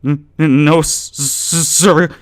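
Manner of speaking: high, loud stammering panic; terrified stutter. Acted. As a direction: stammer in terror. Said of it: "Andy stuttered".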